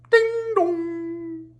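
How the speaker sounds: very high ding dong chime; sung doorbell. Acted. Doorbell rings.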